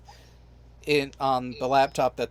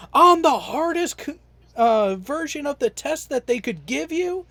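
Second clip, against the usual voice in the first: high-pitched mock outrage, building louder; upset recruiter; 10 semitones higher, 4 dB louder than usual. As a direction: imitate an exasperated recruiter chewing someone out. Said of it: "Why are you up really this upset".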